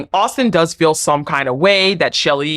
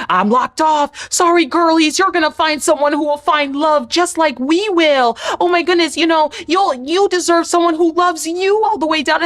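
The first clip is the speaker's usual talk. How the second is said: high, syrupy fake-sweet voice; condescending sweet mimic; mock sugary Huda; 10 semitones higher than usual. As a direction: coo it with fake sympathy. Mocking Huda's sweet jabs.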